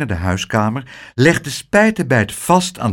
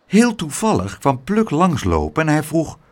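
ordinary delivery, nothing marked

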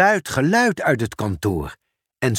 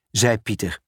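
usual speaking voice

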